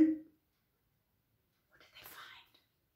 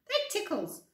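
giggly, tickled; 11 semitones higher than usual. Acted. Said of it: "laughed Floppy".

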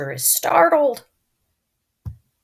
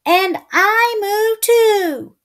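high, slow little calf; 12 semitones higher, slower than usual. Acted. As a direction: squeak it like a tiny calf. Calf voice in story.

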